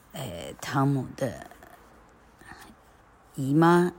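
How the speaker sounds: ordinary delivery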